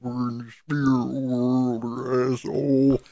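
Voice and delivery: slow, drawn-out put-on film voice; movie quote voice. Quoting the spirit world scene again, slow drawn-out put-on voice. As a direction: stretch each word in a put-on voice. Same movie line, higher take.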